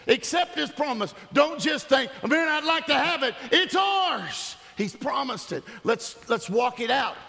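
high, urgent exhortation; full-force preaching. Real, not acted. Pastor urging church to accept his promise, preaching hard.

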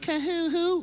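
very high mock sob; pretty cry. Imitating a woman's pretty cry, high dainty sob. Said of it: "Women cry pretty".

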